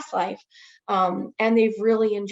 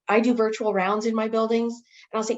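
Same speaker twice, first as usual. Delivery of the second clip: normal talking voice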